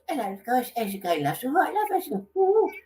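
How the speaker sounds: high, squeaky comic voice, softened; Joe Pasquale. Retold or imitated. Visiting comedian Joe greets the holiday camp entertainers, high squeaky impression. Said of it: "Joe comes and goes".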